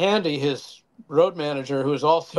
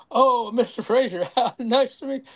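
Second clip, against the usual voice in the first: higher, sudden politeness with a nervous laugh; mock nervous greeting; 8 semitones higher than usual. Texan greeting Joe Frazier after learning who he is, higher, nervous mock politeness. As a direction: play the tough guy suddenly meek and flustered.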